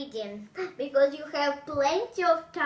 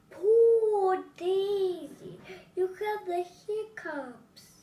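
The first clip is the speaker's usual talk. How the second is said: higher, slow, louder pitying coo; sympathetic mom; 6 semitones higher, 5 dB louder, slower than usual. Acted. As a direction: coo over her like a caring mom. Mother pities hiccuping child.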